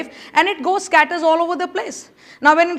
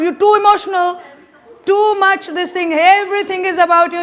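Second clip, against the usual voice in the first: higher, louder mock scolding; mocking accuser; 4 semitones higher, 4 dB louder than usual. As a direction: throw the accusation back at them, a little higher. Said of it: "How many have been told".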